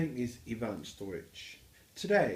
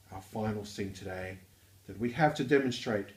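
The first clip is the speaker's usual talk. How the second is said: ordinary talk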